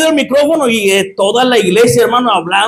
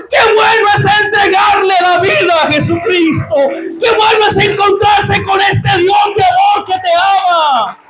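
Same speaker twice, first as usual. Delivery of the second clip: raised, slowed, rapturous testimony; fervent preaching; 6 semitones higher than usual. Acted. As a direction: preach it out to the congregation.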